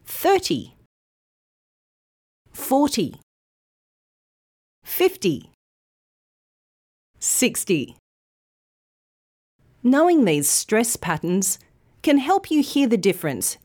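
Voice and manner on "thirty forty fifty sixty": Thirty, forty, fifty, sixty with first syllable long, ty short; first syllable stressed. Shown as a demonstration. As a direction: hit the front of each number. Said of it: "the first syllable is long and clear".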